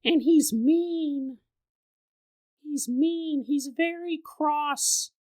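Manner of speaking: put-on a toddler insists to Daddy; insistent little girl. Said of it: "she goes".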